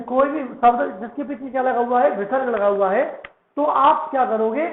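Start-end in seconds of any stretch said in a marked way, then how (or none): none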